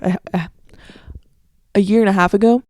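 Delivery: usual speaking voice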